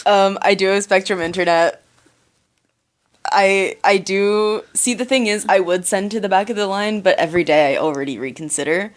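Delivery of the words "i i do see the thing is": ordinary talk, nothing marked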